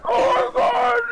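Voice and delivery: high, loud shout of disbelief; excited shout. Real, not acted. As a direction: yell it in amazement. Reacting to clutch play.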